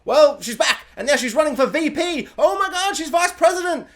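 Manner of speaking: hammed-up "oh my God" rising high; mock excitement. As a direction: gush like a stunned fan. Retold show gasping at twist.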